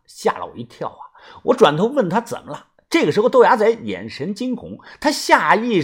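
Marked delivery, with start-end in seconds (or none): none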